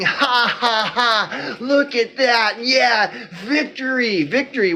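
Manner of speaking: gloating put-on cheer, a bit high; sarcastic laughing victory chant; mock celebrating. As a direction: crow in fake triumph. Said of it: "you all are celebrating".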